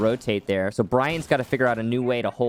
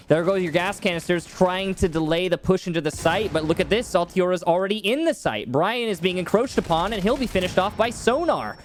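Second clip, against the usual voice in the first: higher-pitched, urgent esports call; live play-by-play; 6 semitones higher than usual. Real, not acted. Caster calling the push into the site, excited play-by-play. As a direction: keep the pitch climbing as the push unfolds.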